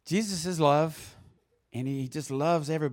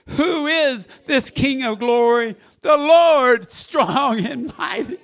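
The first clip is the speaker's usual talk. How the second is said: high, very loud, slow proclamation; exultant scripture shout; 9 semitones higher, 12 dB louder, slower than usual. Real, not acted. Preacher reads of the King of glory, shouting it out. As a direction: shout the psalm out at full force.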